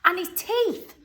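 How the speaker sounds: put-on high excited child's cry. A narrator's reading. Charlie marvels at Grandpa's new teeth, high and excited. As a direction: Charlie crying out in delight. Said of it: "cried Charlie".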